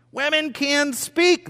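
high, slow proclamation; emphatic declaration. Real, not acted. Preacher tells church women may speak, high emphatic declaration. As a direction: proclaim each word, pitched high.

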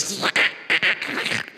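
crackling static made with the mouth; hissy, crackly mock static; imitated radio static. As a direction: mimic an old radio between stations. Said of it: "and it's like".

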